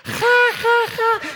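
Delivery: high mock giggle to her brothers; imitated giggle. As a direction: giggle like the little girl. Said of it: "voltea con sus hermanos". (Sofía se ríe con sus hermanos, risita aguda)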